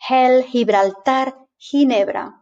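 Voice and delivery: Spanish Gel, Gibraltar, Ginebra with H for G. Shown as a demonstration. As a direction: say Gel, Gibraltar, Ginebra slowly as "hel, hee-bral-TAR, hee-NEH-bra". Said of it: "it's really like an H".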